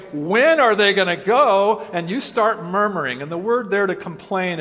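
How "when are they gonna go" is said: fed-up, drawn-out complaint; exasperated host, louder and slower. Retold or imitated. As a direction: let the frustration drag out slowly. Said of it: "you're going".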